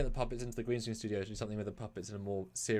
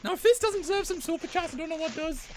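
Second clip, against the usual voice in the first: Scottish puppet voice. Acted. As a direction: greet her like a squeaky Scottish puppet.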